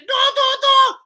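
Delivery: very high, loud beeping "door, door, door"; mock machine alarm squeal; oven alarm imitation. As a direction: mimic a shrieking oven timer.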